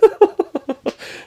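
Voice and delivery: high falling laugh; high amused laugh after the welcome. Real, not acted.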